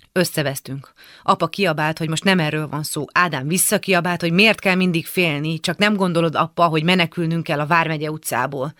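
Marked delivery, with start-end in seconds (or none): none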